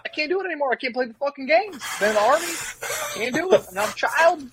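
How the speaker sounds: put-on high-pitched childish whining; hammed-up tantrum, voice climbing high; mock meltdown. Recruit whining to Dornbush that he quits, mock meltdown.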